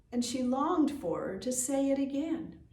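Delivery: usual speaking voice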